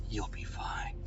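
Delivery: soft, unvoiced whisper. Sensible car reassuring nervous parents, whispered. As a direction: whisper it reassuringly under your breath. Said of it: "something that whispers".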